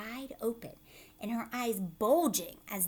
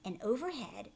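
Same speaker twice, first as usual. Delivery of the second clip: ordinary delivery, nothing marked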